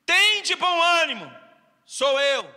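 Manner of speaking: loud, ringing reassurance; proclaiming Jesus. Retold or imitated. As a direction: call out boldly to calm them. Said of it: "Jesus lhe disse".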